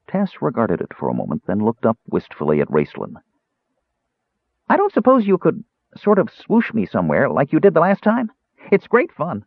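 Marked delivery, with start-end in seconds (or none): 4.7-9.4 high, hopeful kender voice; a young kender begging for a treat; wistful kender. A narrator's reading.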